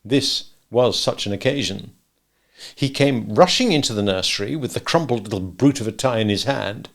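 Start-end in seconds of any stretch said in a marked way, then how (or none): none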